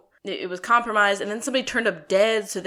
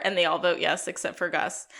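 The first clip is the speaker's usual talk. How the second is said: usual speaking voice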